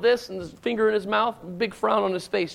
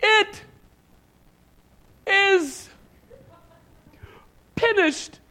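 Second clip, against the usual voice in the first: high, loud words with long pauses between; drawn-out, halting final declaration; dramatic dying cry; 9 semitones higher, 5 dB louder, much slower than usual. Retold or imitated. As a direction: imitate a child acting a death scene, word by word. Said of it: "he goes".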